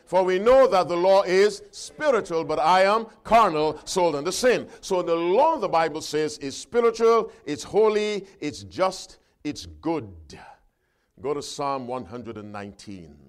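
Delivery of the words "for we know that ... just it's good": loud, raised scripture reading; ringing pulpit delivery; forceful preaching. Acted. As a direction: proclaim each quality of the law with weight. Reads and expounds scripture forcefully.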